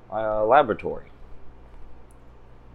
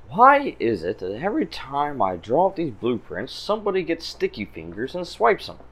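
higher-pitched exasperated inventor; scientist character's complaint; 5 semitones higher than usual. Acted. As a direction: grumble theatrically as a put-upon scientist. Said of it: "You hear a voice".

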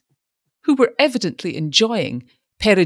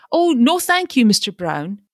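very high, louder alarmed no; snatch it away in panic; startled refusal; 6 semitones higher, 4 dB louder than usual. A narrator's reading. Startled refusal, clutching raincoat.

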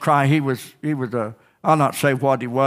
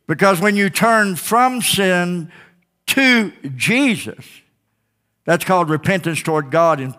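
raised, driving preacher's cry; fiery preaching; 4 semitones higher than usual. Acted. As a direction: push every line out to the congregation. Preaching at full force.

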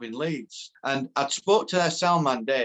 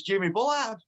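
lightly imitated caller giving his name, high pitch; Jimmy Bullard; 7 semitones higher than usual. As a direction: announce yourself down the phone, pitched up. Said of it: "this voice on phone".

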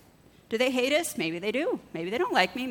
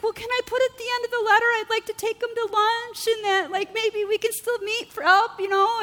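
high, fast, sweetly eager voice; mock sweet naive self; 12 semitones higher than usual. Preacher mimicking her own eager offer to the elders, high and sweet. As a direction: pipe it up like a naive do-gooder. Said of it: "And I was like".

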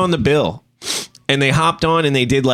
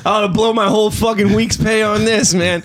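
mock outraged concertgoer; 6 semitones higher than usual. Mock concertgoer at beer prices.

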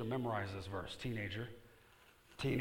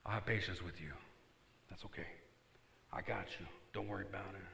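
forgiving friend; 3 semitones lower, 5 dB quieter, faster than usual. Pretend. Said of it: "And he says".

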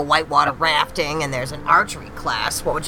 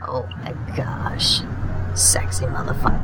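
whispering. Acted. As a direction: whisper it under your breath. Whispering over her date.

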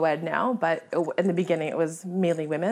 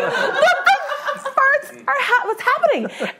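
high squealed disbelief with laughter; comic panic; 4 dB louder, slower than usual. Retold or imitated. Hunter as the bird flies off again, panicked squeal.

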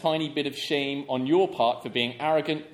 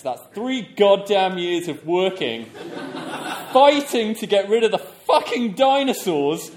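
laughing; 3 semitones higher, 6 dB louder than usual. Real, not acted. Reading the writer's angry letter.

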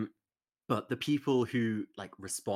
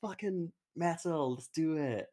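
hyped mock cheer; 7 semitones higher, slower than usual. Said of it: "And I was like".

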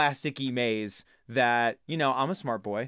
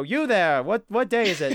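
loud, raised call down to the street; booming Christmas-morning call from the window; 7 semitones higher, 5 dB louder than usual. Pretend. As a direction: hail a child from a high window like an old gent. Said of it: "I swing open the windows early in the morning and I go".

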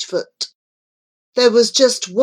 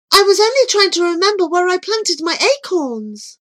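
put-on young girl voice; 11 semitones higher than usual. Girl's voice, narrator reading.

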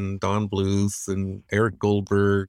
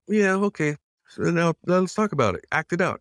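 higher, brisk mentor urging him on; Glenn's eager coaching; 7 semitones higher than usual. Retold or imitated. Animator retelling his mentor telling him to act it out, higher. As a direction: push the student to act it out. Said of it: "And he was like".

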